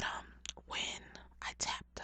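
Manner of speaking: ordinary delivery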